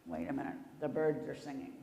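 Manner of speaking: ordinary delivery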